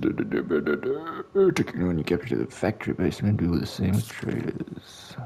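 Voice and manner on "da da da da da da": ordinary delivery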